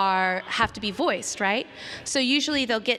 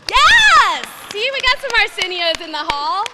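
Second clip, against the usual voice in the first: high, loud holler to the crowd; real high-pitched celebratory shout; excited cheer; 11 semitones higher, 11 dB louder than usual. Real, not acted. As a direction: shout it triumphantly over the whoops. Cheering back at whooping audience.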